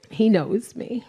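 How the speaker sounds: ordinary delivery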